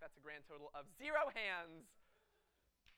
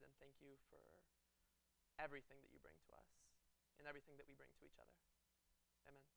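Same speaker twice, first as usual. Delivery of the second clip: prayer; 2 semitones lower, 5 dB quieter than usual. Acted. Student pastor praying with the congregation, soft prayer voice. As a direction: pray over the room gently.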